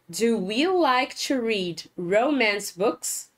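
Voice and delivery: slow, careful repeat of the question. Shown as a demonstration. Teacher repeating question for students, slow. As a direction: say it again slowly for the class.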